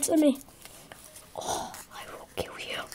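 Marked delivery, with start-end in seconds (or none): 1.4-2.8 mock threat whisper. Mock threat to non-subscribers.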